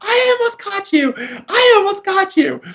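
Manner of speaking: very high, squealing giggly falsetto; teasing laugh. Retold or imitated. Girlfriend laughing that she almost read his thoughts, in a high squeal. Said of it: "she just starts laughing and she's like".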